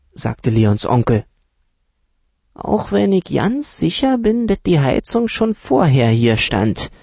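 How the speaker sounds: put-on a know-it-all dealer lowballing the children; uncle's voice. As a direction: play the condescending uncle talking down to the kids. Uncle voice again.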